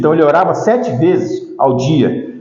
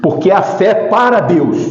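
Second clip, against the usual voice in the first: ordinary talk, nothing marked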